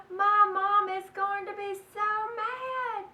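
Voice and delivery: put-on high, loud fretful kid voice; a little girl panicking about her mom; worried child. Child fretting about mother.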